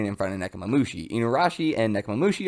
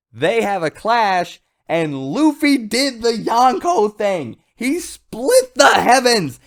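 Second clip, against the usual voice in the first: super hyped; 9 semitones higher, 3 dB louder than usual. Real, not acted. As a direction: belt it out like your favourite hero just won. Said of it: "got super hyped again just saying it".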